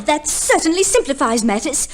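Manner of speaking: ordinary delivery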